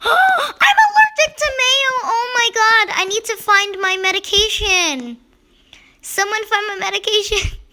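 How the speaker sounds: high, loud gasping pretend panic; mock allergy panic. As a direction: cry out as if the mayo just hit. Avatar allergic to mayo panic.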